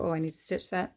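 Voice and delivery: normal talking voice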